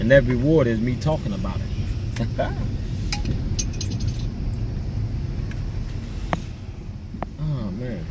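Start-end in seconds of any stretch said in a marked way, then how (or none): 2.3-7.3 breathy high wheezing laugh; real laugh at his own joke; long high laugh. Real, not acted.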